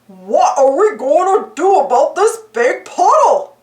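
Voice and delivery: dopey cartoon dog voice, puzzled; goofy drawling sidekick asking what now. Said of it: "Goofy asked".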